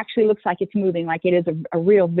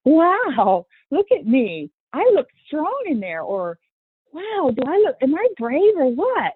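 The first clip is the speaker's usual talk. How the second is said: put-on proud kid; 7 semitones higher, slower than usual. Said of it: "turn around and say".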